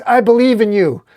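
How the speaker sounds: screamed encouragement; high, loud heartfelt rallying yell; Superman. Acted. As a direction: scream your faith in a friend. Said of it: "Superman screams".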